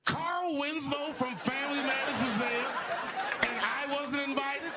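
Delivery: very high, incredulous squeal of offence; mock outrage. Comic left out of the party, high indignant disbelief. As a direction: pitch it way up, comically wounded.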